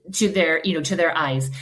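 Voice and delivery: ordinary talk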